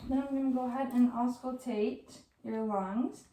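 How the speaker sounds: normal talking voice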